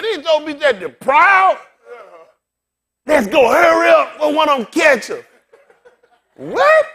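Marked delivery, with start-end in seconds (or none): 3.1-5.2 loud hurried shout to the crew; hurried call. Retold or imitated. Comic urging his boys to hurry, loud call. 6.4-6.9 high loud squeak of disbelief; shocked what. Acted. Comedian reacting to crowd, high startled squeak.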